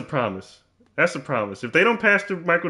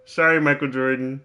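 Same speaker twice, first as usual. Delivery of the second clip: normal talking voice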